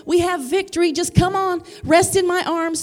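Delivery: driving, emphatic sermon delivery; impassioned preaching. Real, not acted. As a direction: build the promise with fervour for the congregation. Preaches, voicing the Father's promise.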